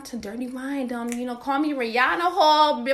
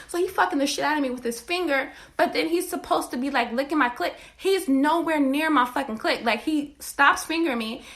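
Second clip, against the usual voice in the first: normal talking voice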